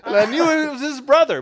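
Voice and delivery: cracking up at your own mix-up; laughing while talking. Real, not acted. Podcaster to co-host, laughing at forgetting the villain was German.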